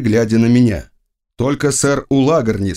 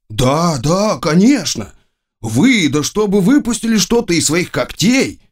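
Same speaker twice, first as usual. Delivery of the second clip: animated courtier's outburst, pitched up; emphatic exclamation; 6 semitones higher than usual. A narrator's reading. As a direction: exclaim it high, as if it goes without saying. Courtier exclaims at emperor's claim.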